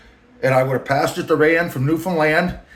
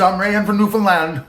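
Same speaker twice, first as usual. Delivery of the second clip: higher mock boss voice, slowing at the end; Rand's voice; 6 semitones higher, 3 dB louder than usual. The boss describing his looks, in a high mocking voice. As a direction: lampoon the boss rambling about himself. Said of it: "I'm just filling in voice".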